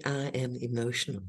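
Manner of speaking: 1 quiet admission. Shown as a demonstration. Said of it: "the biggest thing is to say".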